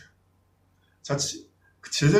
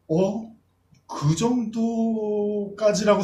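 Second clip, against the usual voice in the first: surprised disciples; 7 semitones higher, much slower than usual. Retold or imitated.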